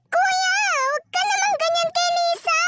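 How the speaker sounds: put-on pleading girl. Sister pleading with Kuya for Lisa, high. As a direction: plead for her friend.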